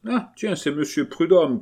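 high-pitched urchin calling out; lightly acted kid's shout; street boy's cry. A narrator's reading. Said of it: "cria un gamin".

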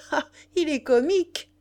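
high, loud exclamation of delight; tickled, ringing outburst; delighted cry. A narrator's reading. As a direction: cry out, thrilled at the find. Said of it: "cria Rouget ravi".

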